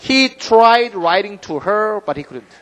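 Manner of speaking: very slow, careful reading; slow example reading. Shown as a demonstration. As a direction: lay out each word slowly. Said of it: "예문 한번 봅시다". (아주 천천히 예문 읽기)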